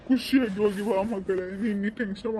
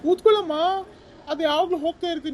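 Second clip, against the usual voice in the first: much higher, louder, fast call to sit; loud order to sit; 9 semitones higher, 7 dB louder, much faster than usual. Acted. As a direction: shout at her to sit back down. Driver tells passenger sit down.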